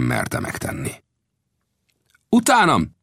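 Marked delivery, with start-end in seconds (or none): 2.3-3.0 very high rallying cry; shouted call. Acted. Old Death leading his men from the fire, calling out. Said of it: "kiáltotta Old Death".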